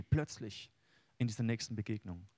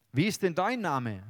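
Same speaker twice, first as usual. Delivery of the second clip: higher and louder asking; eager question; 5 semitones higher, 6 dB louder than usual. Acted. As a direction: press for the name. Jakob asks in Bible reading.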